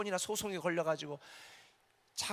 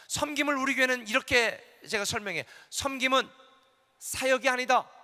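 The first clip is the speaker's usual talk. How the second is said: louder, higher preaching emphasis; 8 semitones higher, 8 dB louder than usual. Acted. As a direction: hammer the point home to the congregation.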